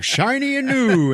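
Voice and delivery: very high, loud, drawn-out singsong gush; gushing wonder. Retold or imitated. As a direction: stretch it out in showy delight. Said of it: "it's all, like".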